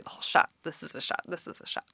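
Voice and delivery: fast breathless whisper, imitating; whispered catchphrase. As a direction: rush it out in a whisper. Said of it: "he's got his catchphrases".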